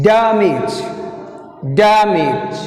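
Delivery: Damage with long DAM, falling -age; first-syllable stress. Shown as a demonstration. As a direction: press hard on DAM in Damage. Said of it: "Stress is first syllable".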